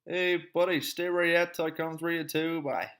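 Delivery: calling out to a buddy, loud and high; loud casual call. Shown as a demonstration. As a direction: holler it casually across the room. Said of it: "So we're just gonna go".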